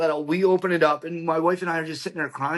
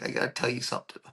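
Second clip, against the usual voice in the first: hushed secret; 7 dB quieter, faster than usual. Retold or imitated. Utah confides a secret.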